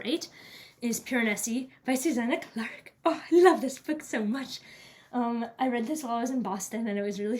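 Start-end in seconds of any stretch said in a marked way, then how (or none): 3.1-4.6 excited. Real, not acted. Gushing over a favourite book.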